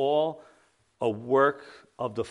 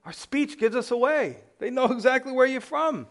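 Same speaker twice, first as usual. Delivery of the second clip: high-pitched comic exclamation; mock exasperation, pitched way up; heightened punchline; 12 semitones higher, 3 dB louder than usual. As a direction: keep the bit's pitched-up voice going. Punchline of the accent bit, heightened.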